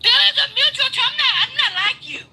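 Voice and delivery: heated shout at the host; angry shout. Real, not acted. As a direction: shout him down in anger.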